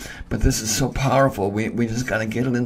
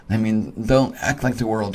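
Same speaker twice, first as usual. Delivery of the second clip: ordinary talk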